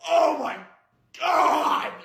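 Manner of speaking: high, loud, over-the-top cry; overwhelmed exclamation. Acted. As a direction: cry out as if blown away by the taste. Acted overwhelmed reaction to food.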